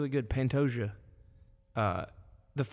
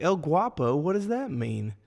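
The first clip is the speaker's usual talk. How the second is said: mocking imitation; 4 semitones higher, 5 dB louder than usual. Mimics co-host's naive interview question.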